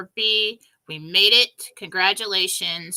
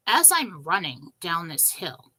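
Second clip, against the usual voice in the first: usual speaking voice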